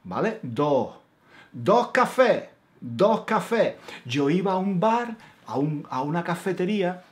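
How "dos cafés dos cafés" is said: "Dos cafés" in a Granada Andalusian accent, both S sounds dropped. Shown as a demonstration. Teacher demonstrating the Andalusian order for two coffees. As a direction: Andalusian "do kafé" with open vowels. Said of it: "no pronunciamos la S al final".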